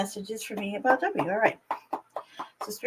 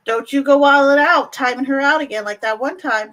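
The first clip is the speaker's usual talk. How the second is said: playful scolding; 3 semitones higher, 8 dB louder than usual. Pretend.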